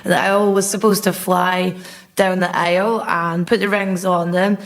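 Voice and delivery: ordinary talk, nothing marked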